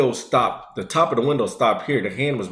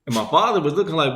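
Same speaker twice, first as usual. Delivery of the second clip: usual speaking voice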